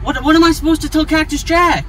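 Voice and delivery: usual speaking voice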